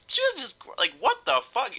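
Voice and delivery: squeaky high-pitched retold shock; shocked disbelief. Reacting to hearing his ex wanted to kill him, high shocked squeak. Reacting to ex's murder threats.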